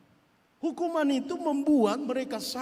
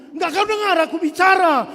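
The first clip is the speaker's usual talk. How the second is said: much louder, higher angry scold; scolding parent; 9 semitones higher, 12 dB louder than usual. Retold or imitated. Mother scolding her child, loud and angry. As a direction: snap at the child who isn't listening.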